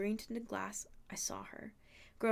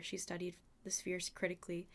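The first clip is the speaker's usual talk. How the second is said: ordinary delivery, nothing marked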